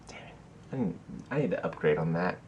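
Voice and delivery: quick, hushed note to self; under-the-breath whispered aside. Real, not acted. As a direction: drop into a fast whisper, as if to yourself.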